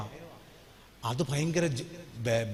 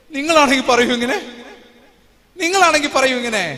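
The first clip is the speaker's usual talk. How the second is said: loud, high, crying out the question; loud tearful plea; 7 semitones higher, 11 dB louder than usual. Retold or imitated. Wife sobbing asks her husband would he say this, loud and tearful. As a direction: plead through tears.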